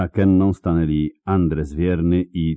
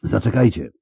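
calling out; 5 semitones higher, 3 dB louder than usual. A narrator's reading. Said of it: "zawołał Dufisej Krill".

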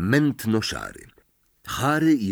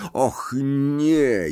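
higher, dismayed moan on the second word; groaning; 4 semitones higher than usual. A narrator's reading. Ron sees the red envelope, groaning. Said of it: "jęknął Ron".

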